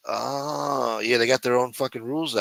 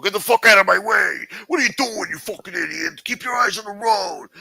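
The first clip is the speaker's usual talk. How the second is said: high, loud mocking yell at other drivers; mock road-rage driver; 11 semitones higher, 6 dB louder than usual. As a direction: ham up the crazy driver screaming out the window. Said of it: "telling people".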